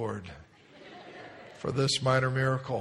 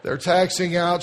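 usual speaking voice

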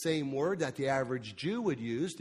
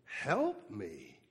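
soft, low, hushed help me; quiet plea; 7 semitones lower, 6 dB quieter than usual. Shown as a demonstration. Congregation echoing a desperate plea, hushed. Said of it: "say those words".

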